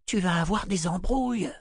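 high, hushed worry; anxious, thin-voiced caution; worried warning. A narrator's reading. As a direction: warn your mate nervously, high-pitched. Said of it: "chuchota-t-il inquiet".

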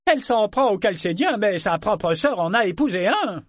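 high, mocking heckle from the crowd; sneering jibe. A narrator's reading. Someone in the crowd mocking Althea's family, sneering. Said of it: "dit quelqu'un en ricanant".

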